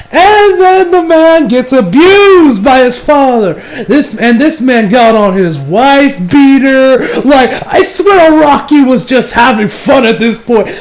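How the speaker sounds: amused disbelief, laughing while describing. Real, not acted. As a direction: laugh your way through the words. Laughing at abusive stepfather scene.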